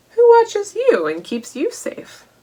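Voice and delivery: usual speaking voice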